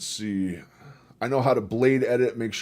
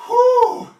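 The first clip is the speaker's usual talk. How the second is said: high, loud whew of relief; loud exhale; 12 dB louder than usual. Real, not acted. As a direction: blow out after the effort. After push-ups.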